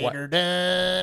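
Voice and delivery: slow, loud put-on old-timer; slow hammed-up old man voice; mock old man. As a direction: drag it out like a gruff old-timer. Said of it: "why are we sounding like old Chinese people".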